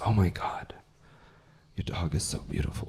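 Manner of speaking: soft, low, awed imitation; hushed gushing. Comic voices a woman admiring his dog, hushed. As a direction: imitate a quietly smitten admirer.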